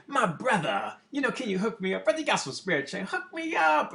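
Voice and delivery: imitating a begging bum. A bum hustling the passer-by for spare change, pleading. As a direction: ham up a wheedling street hustler.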